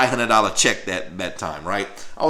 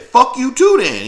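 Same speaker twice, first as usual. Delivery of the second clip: high, louder, slow defiant comeback; retold angry retort; 12 semitones higher, 6 dB louder than usual. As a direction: throw it back at him, slowly. Snapping back at the sergeant.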